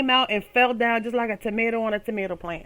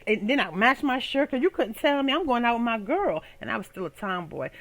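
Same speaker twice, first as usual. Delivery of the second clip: ordinary talk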